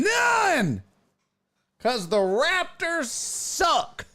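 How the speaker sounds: very high, drawn-out mock yell; squealed taunt, stretched on the last word. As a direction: crow it at a rival fan. Taunts a Canadian with own answer.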